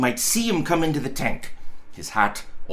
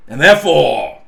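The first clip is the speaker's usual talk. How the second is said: loud and therefore; 10 dB louder than usual. Acted. Scrooge builds to a loud reveal.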